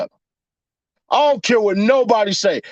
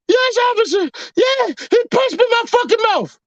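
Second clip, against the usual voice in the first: mock girlfriend; 12 semitones higher than usual. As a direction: play the lying girlfriend to the cop. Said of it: "your girl opens the door".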